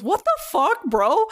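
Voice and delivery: imitating a startled man, voice jumping high; high-pitched mock protest at being hit; startled outburst. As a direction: yelp it like someone just pelted. Quoting man hit by pebbles.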